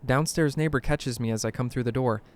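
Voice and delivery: ordinary delivery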